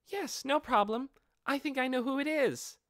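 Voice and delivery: high, bright-voiced young girl; a mischievous kid answering breezily; playful dark elf girl. Aura answering how long she hid, high playful girl.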